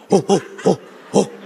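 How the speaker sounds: low, breathy ape hooting; imitated gorilla "oh, oh"; gorilla hoots. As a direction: puff out a run of gorilla hoots. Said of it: "[name] keeps going".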